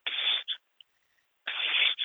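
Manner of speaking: soft, voiceless hiss for attention; imitated hushed beckoning; furtive psst. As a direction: secretly get his attention. Star secretly beckoning from round corner.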